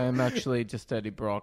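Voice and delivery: imitating an action star's accent, stiff and deadpan; mock Dolph Lundgren accent. Podcast host imagines Dolph as Eddie Brock, mock accent. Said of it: "I don't even know if they has that accent".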